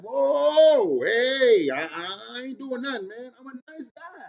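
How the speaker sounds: startled, backing off with a mock-innocent plea; hammed-up defensive nice guy; mock scared protest. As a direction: play it like a guy caught off guard pleading innocence. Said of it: "I'm like".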